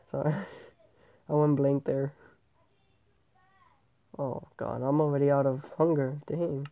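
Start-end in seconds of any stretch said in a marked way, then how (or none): none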